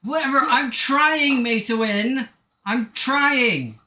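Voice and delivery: loud, high self-defence; exasperated protest. Real, not acted. Defends own attempt to teammate.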